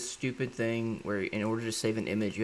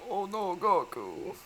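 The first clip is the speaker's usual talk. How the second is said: much higher, lovestruck sigh; swooning Vegeta; 10 semitones higher, slower than usual. Retold or imitated. Vegeta swooning over Goku, high falsetto.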